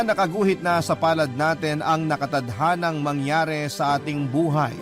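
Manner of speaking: ordinary talk, nothing marked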